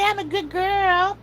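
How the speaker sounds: put-on high, slow baby voice for the dog; voicing the dog. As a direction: speak for the puppy, high and slow.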